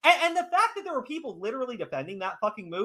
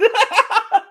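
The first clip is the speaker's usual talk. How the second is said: high burst of laughter; 14 semitones higher, 7 dB louder than usual. Real, not acted. Laughing at his own joke.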